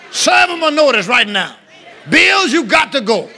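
high, ringing proclamation; full-force preaching. Real, not acted. Preacher evicting bills before the congregation, forceful shout. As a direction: order the bills out, then declare it proudly.

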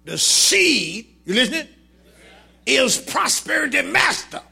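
high, loud preaching shout. Acted. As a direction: lift the church with a shout. Preaching at full force.

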